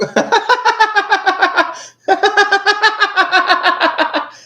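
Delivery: long, loud, high-pitched laughing fit; uproarious laughter. Real, not acted. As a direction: burst out in helpless, sustained laughter. Cracks up at what was said.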